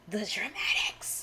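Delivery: slow, stagey whisper; hushed theatrical reveal; dramatic whisper. Acted. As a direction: whisper it slowly for maximum drama. Theatrical emphasis on story drama.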